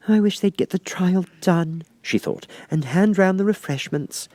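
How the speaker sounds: put-on high, light girlish voice; lightly high Alice wishing. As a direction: voice young Alice, a little impatient. Alice's voice in read story.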